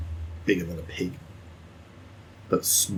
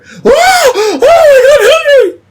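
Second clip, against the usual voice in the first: a high, loud cry from inside the house; panicked farmer calling out from afar; distant frantic yell; 15 dB louder, much slower than usual. Acted. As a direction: shout for Henry at the top of your voice.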